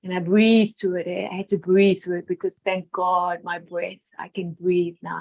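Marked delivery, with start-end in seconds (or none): none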